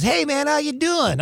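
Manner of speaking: high-pitched, breezy greeting. Retold or imitated. Rock star greets him backstage next day, upbeat high voice. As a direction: imitate an upbeat rocker saying hello.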